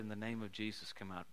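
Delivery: low, soft "In the name of Jesus, come out"; quiet command. Shown as a demonstration. Preacher showing the quiet command to the demon. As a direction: say it low and sure, nearly under the breath. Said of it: "it's not about the delivery".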